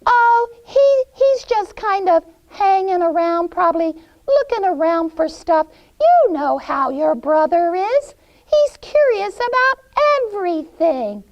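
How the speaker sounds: put-on high, loud old-lady voice; dissembling witch. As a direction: brush the girl off airily, high-pitched. Witch brushes off Gretel's question.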